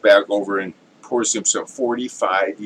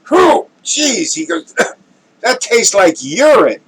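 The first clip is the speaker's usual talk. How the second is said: much higher, louder shriek of disgust; disgusted connoisseur; 12 semitones higher, 6 dB louder than usual. Acted. Connoisseur to the drunk, high loud disgusted outcry. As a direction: recoil in horror at the taste.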